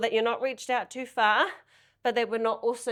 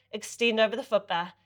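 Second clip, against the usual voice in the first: ordinary delivery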